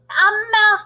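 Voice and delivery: high shrill-free shout of alarm. Acted. Kitchen staff spotting the mouse, loud high shout. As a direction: yell it out in panic. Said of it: "they were shouting".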